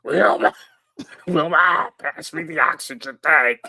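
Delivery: high wheezy cartoon plea. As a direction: call out weakly like Fred. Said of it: "Fred in iron lung".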